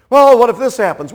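loud, high anxious question; mock worrier. Preacher voicing a worried listener, high and loud. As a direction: fret out the what-if. Worrier voiced in back and forth.

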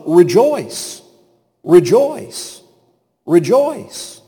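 high, spaced-out exhortation; insistent refrain. Acted. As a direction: call it out as a repeated refrain. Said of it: "his refrain over and over again".

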